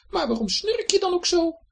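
very high little flea; curious tiny creature. A narrator's reading. Flea character asks the snore. (hoog vlooienstemmetje)

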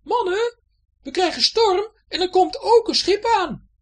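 high, loud warning shout down below; calling as loud as she can. Acted. Betje shouting down to the pirates below, loud alarm. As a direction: yell a storm warning to the crew. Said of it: "riep zo hard ze kon".

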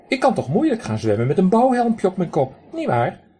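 high, loud duck voice with a raspy quack. Acted. Drake lectures the man who threw pebbles, high raspy quack. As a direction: play a cheeky drake scolding a stranger.

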